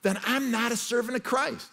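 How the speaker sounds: high, loud preacher's declaration; impassioned preaching. Real, not acted. Preacher warning the congregation about pleasing others over God, impassioned. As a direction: drive each point home, loud and high.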